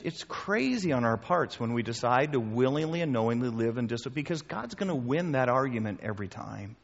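ordinary talk, nothing marked

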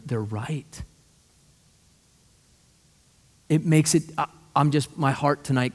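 low, soft, heartfelt preaching; earnest appeal. Real, not acted. Preacher appealing to parents about their children, hushed and earnest. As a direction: drop low and quiet, pleading sincerely.

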